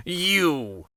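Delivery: a disbelieving, sneering "You!"; scoffing. Acted. Vizier scoffing at the girl who offers to break the spell. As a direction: spit it out as if she is a joke. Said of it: "scoffed the Grand Vizier".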